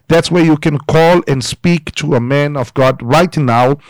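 ordinary talk, nothing marked

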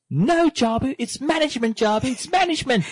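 high, prissy correction of management; condescending corrector. Retold or imitated. Corrector scolding Jabu about management, high and prissy. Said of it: "correcting Jabu".

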